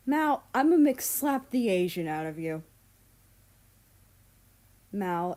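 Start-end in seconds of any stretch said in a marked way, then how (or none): 0.1-2.6 mock threat. Threat in group chat.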